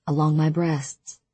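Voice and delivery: ordinary delivery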